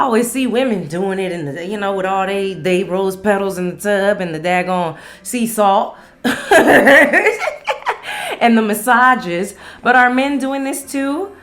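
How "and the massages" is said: high laugh spilling into slow words; amused laugh. Real, not acted. Host teasing women's spa rituals, laughing. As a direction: laugh it off, then drag the word.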